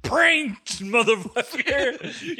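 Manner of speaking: high, loud laughing "pranked"; mock prank shout. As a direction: yell it like a prank reveal, laughing and stretching it out.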